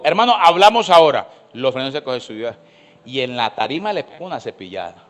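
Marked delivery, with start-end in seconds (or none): 0.0-1.3 stern call, clearly louder; commanding summons; firm pastor. Retold or imitated. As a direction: pastor summons him sharply. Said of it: "le dijo".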